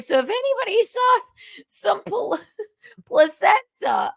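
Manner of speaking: high voice breaking into laughter; laughing retold joke. Comic retelling a pregnant friend's stage joke, laughing. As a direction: quote the stage joke through giggles, high.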